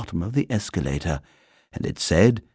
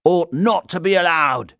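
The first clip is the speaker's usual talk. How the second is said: indignant; 9 semitones higher, 4 dB louder than usual. Acted. Indignant bystander in a story.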